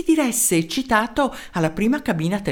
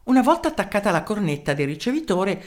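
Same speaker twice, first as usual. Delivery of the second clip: ordinary delivery, nothing marked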